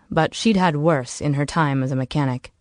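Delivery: usual speaking voice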